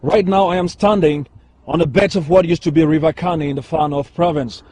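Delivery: on-location reporter, steady news delivery; news field reporter. Acted. On-location climate change report.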